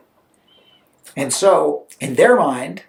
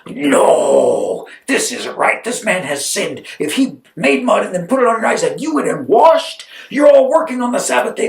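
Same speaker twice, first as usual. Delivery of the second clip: indignant accusation; 3 semitones higher than usual. Acted. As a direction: condemn them in mock outrage. Pharisee condemns the healing.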